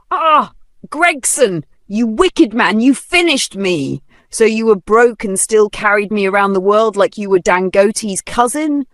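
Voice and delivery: high, loud bitter tirade; angry rant. Acted. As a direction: rail at him like a betrayed wife. Widow raging at dead husband.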